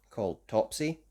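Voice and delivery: usual speaking voice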